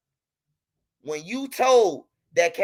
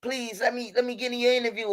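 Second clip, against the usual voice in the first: mock begging; 5 dB quieter than usual. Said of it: "a motherfucker begging me".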